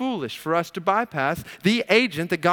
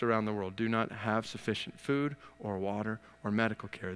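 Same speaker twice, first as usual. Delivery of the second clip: sober and earnest; 7 semitones lower, 7 dB quieter than usual. Acted. Pastor telling the church of starving believers, hushed and earnest. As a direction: speak gently of brothers starving.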